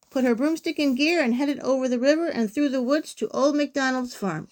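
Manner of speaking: ordinary delivery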